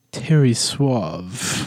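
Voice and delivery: drawn-out, slow smooth name; suave name. Shown as a demonstration. Introducing the suave spy character, slow smooth name. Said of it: "His name is".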